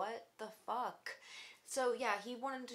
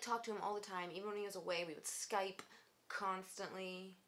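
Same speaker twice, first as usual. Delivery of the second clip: ordinary talk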